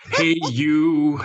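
amateur character impression; George McFly. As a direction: imitate a film dad trying to confront someone. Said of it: "Marty McFly's, uh, dad, George".

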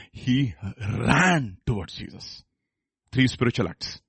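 usual speaking voice

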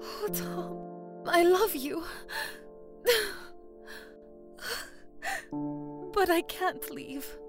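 high, soft tearful woman's voice; breathy sobs between the phrases; still crying. A narrator's reading. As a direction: weep softly through the words, pitched high. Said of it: "still crying".

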